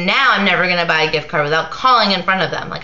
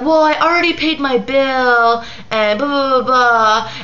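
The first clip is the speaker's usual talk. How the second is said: higher mimicking voice, dismissive; mocking girl's excuse; 6 semitones higher than usual. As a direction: parrot her excuse up high, then trail into blah.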